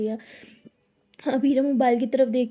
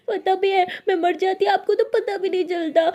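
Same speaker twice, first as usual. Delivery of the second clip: higher, pleading and tearful; hurt reproach; 7 semitones higher, 3 dB louder than usual. A narrator's reading. Abira reproaching Anokhi for ignoring her, tearful. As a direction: let the hurt spill out.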